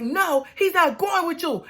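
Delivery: high-pitched mock refusal; defiant refusal. [name] warding Sarah off Michael, defiant refusal.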